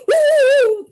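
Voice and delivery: high loud playful woo; spooky woo. Real, not acted. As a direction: thrill of a scare. Loved getting scared as kid.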